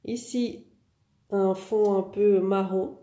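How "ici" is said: usual speaking voice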